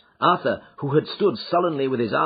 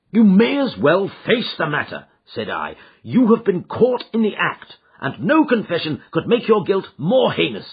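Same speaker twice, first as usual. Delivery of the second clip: louder, higher severe rebuke; stern confrontation; 5 semitones higher, 5 dB louder than usual. Acted. Father confronting his son caught in the act. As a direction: press him hard to confess.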